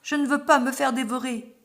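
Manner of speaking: plainte d'enfant effrayé, plus forte; gémir sa peur d'être mangé; gémit. A narrator's reading. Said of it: "gémit Minilou". (gémissement apeuré du petit loup)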